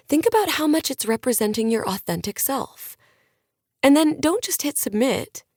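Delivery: pep the audience up like a lifestyle guru; a hint of a motivational influencer addressing fans; upbeat influencer on stage. Maddie gives advice on stage.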